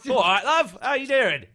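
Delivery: loud, high Cockney greeting; Cockney-esque accent. Shown as a demonstration. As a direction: greet her like a cheery Cockney. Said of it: "it was Cockney-esque".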